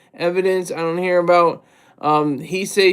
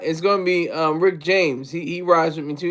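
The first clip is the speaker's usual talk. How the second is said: ordinary delivery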